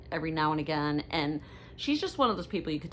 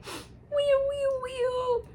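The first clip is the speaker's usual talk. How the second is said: high siren wail; much slower than usual. Acted. Mock siren about last son.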